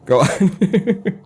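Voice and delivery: imitating a flustered professor waving it away; mock flustered professor. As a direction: brush it off, pitch climbing. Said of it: "No, no, no, this doesn't matter".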